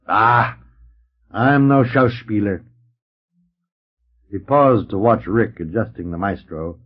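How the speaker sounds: ordinary delivery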